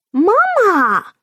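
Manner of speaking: put-on a small boy shouting for his mother; little boy running to his mother, calling out loud and high; child calling mama. Small son runs to mother.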